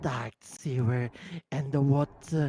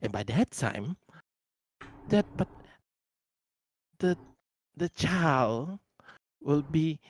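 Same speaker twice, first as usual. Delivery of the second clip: ordinary delivery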